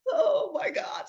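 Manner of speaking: high, sobbing cry of "Oh my God"; overwhelmed tearful outburst. Retold or imitated. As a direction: break down crying as you cry out. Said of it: "I just started crying".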